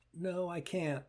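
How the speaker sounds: soft, small plaintive repeat; a wounded part pulling back; mild voice of the hurt part. Shown as a demonstration. The hurt part rocking back, softly plaintive. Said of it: "this traumatized part of you".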